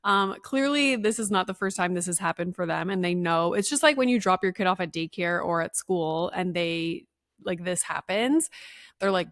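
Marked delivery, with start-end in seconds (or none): none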